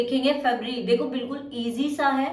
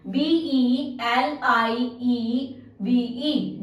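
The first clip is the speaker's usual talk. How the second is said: slow spelling out; much slower than usual. Shown as a demonstration. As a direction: spell B E L I E V E letter by letter, very slowly. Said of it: "अब believe की spelling क्या होगी?".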